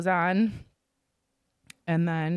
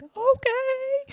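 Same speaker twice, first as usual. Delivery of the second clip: meek okay. Retold or imitated. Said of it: "And I'm like".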